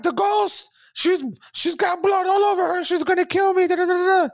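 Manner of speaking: high, loud panicked shriek; terrified scream. Retold or imitated. Terrified boy shrieking to his mom through the door. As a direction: scream for your life to your mom. Terrified teen describing ghost.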